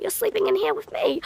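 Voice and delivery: high-pitched impression of a creepy man; mock landlord voice. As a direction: put on his high, insinuating voice.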